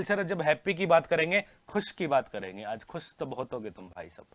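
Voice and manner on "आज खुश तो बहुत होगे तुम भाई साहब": low, hushed movie-line delivery; film dialogue. Acted. Teacher quotes a film line to students, low and hushed. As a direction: drop down deep and quiet for the famous line.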